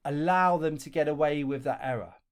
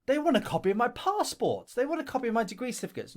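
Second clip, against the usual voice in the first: louder, higher, indignant protest; exasperated complaint; 7 semitones higher, 3 dB louder, faster than usual. Retold or imitated. Job applicant complaining about handing over documents, indignant. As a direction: sound fed up with all the paperwork.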